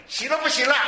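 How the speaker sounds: loud, high challenge to the congregation; calling out. Real, not acted. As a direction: throw the question out high and loud. Calls question out to congregation. (高声向会众发问)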